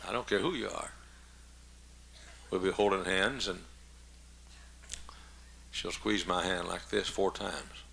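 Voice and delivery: ordinary talk, nothing marked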